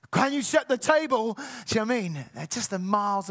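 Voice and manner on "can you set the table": snapped out higher and louder, "Can you set the table?" as a sharp demand; harsh tone. Shown as a demonstration. Parent snapping at family over setting the table, harsh. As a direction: bark it with a sharp edge. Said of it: "a harshness in tone".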